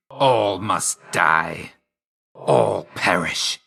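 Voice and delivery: slow, drawn-out death pronouncement; slow menace. Acted. Death dealer condemning enemies, slow menace. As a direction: draw out each word like a doom.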